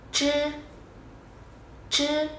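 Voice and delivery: "chư" tròn miệng, lưỡi cong; "chư" không bật hơi, lưỡi cuộn; tiếng Trung zh. Shown as a demonstration. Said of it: "Đây là một âm không bật hơi". ("chư" cong lưỡi không bật hơi)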